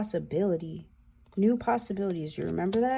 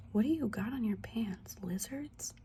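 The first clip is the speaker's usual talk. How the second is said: whisper; 2 semitones higher, 8 dB quieter than usual. Real, not acted. Aside about the card's pants.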